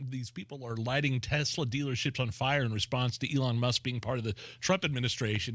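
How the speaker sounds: ordinary talk, nothing marked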